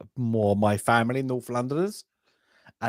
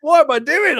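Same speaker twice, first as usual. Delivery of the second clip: high, loud mock-dismayed cry; loud self-mocking outburst; retold dismay; 14 semitones higher, 8 dB louder than usual. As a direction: exclaim it in comic disbelief, pitch leaping up. Retells own dismayed reaction.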